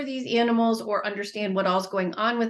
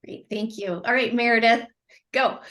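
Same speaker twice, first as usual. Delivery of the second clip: ordinary talk, nothing marked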